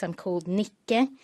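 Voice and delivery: normal talking voice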